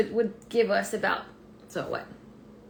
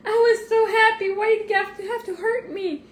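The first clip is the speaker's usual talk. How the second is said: put-on higher, louder tearful wail of a hurt child; imitating whiny crying; 11 semitones higher, 7 dB louder than usual. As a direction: whine through mock sobs about the finger. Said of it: "her finger was swelling".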